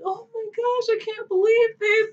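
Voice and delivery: mocking a scared cellmate, high and whiny; panicked girl. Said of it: "they're like".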